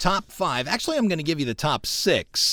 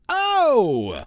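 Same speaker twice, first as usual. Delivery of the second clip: high, loud shouted "Oh!"; performed soul "Oh!"; 10 semitones higher, 5 dB louder than usual. Shown as a demonstration. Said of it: "the performance of the word".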